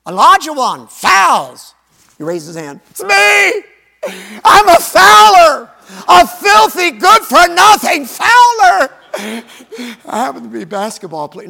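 high, loud, wailing mock confession; mock self-pitying player. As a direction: ham up a player groveling over his fouls. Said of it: "Can you imagine?".

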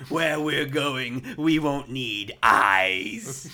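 very slow, loud, hammed-up villain line; scenery-chewing. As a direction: draw out every word and chew the scenery. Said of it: "fucking chewing the scenery".

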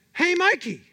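recalling the Life cereal ad; ad kid voice. Retold or imitated. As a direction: call out like the kid in the ad. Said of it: "Life cereal commercial with Mikey".

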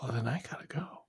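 soft conspiratorial whisper. Acted. Partner whispering about their business deal, whispered. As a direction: hush it so no one hears. Hushed plotting between partners.

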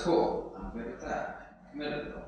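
muttering like a smug crowd; mock relieved aside. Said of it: "And the crowd goes".

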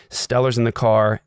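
usual speaking voice

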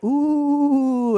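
high, loud, drawn-out ooh; long mock gasp of amazement; awed crowd ooh. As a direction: imitate a crowd gawking in wonder. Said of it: "everyone's like".

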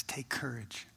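a hushed whisper of Take courage; whispered encouragement. Acted. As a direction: whisper it, soft and intimate. Jesus' words to Paul.

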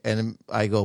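usual speaking voice

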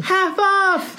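high, bright announcement; excited. Acted. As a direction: sell the deal with delight. Calling out sale.

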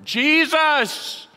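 high, loud desperate shout. Acted. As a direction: yell his name over the crowd. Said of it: "Bartimaeus cried louder".

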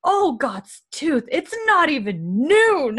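slow, high, loud grumbling; hammed-up high grumpy complaint; grumpy Jordy. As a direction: drag it out like a put-upon old grump. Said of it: "he goes".

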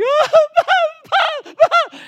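high, loud mock wail; hysterical sobbing. Granddaughter wailing to her grandpa, hysterical mock sobbing. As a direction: bawl like a hysterical little child. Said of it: "not just, like, sobbing".

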